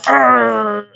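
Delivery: a sudden high strained "Arr!", arms locked tight; tense straining grunt. Shown as a demonstration. Instructor showing students the wrong tensed-up way, strained grunt. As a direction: grunt like you're forcing every muscle. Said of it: "You don't wanna go".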